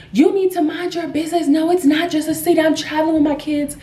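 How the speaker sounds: high, indignant retold rant; mock screaming. Mother rounding on a passenger on the phone, mock screaming. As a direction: imitate an irate mother laying into someone, pitch held high. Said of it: "screaming at this lady".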